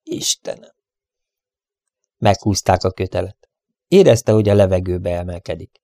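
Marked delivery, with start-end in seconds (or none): 0.0-0.7 soft, voiceless gasp of a prayer; groaned. A narrator's reading. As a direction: groan it out with no strength left. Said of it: "csak annyit tudott kinyögni". (erőtlen, halk nyögés)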